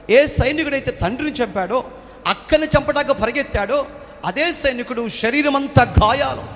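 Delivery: dramatic pulpit storytelling; rising preaching. Acted. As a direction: preach the war story with mounting force. Preacher builds the war story.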